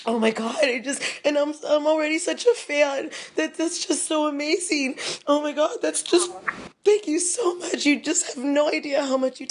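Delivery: real tearful voice, pitched high; choked up with gratitude; happy tears. Real, not acted. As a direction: talk through happy tears. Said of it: "it's happy tears".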